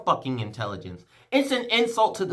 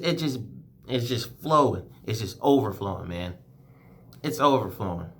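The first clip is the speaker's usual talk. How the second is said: real emotion welling up; 11 semitones lower, 5 dB quieter than usual. Real, not acted. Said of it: "I'm getting emotional".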